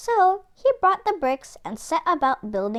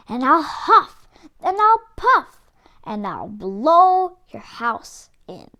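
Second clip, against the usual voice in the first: louder, building wolf threat; 4 semitones higher, 7 dB louder than usual. Acted. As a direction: huff it up big and menacing.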